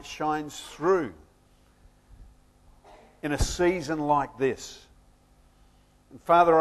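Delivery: low, soft congregational prayer. Real, not acted. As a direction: pray quietly and unhurriedly, with pauses.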